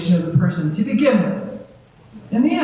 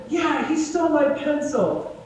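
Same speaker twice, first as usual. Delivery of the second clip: put-on higher, slow childish whine; kid defending himself; 7 semitones higher, slower than usual. Child tells the adult why he hit, a higher slow whine. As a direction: plead your case like a wronged kid.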